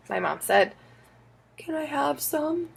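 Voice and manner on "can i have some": timid request. Acted. Child asks softly.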